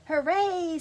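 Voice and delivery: high, loud joyful cheer; excited cheer. Acted. Girl cheering on her first school day, excited. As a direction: shout hooray with delight.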